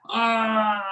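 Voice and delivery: loud high yelp of alarm; shout. Acted. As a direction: cry out as they drag you off. Storyteller yelled as thrown out.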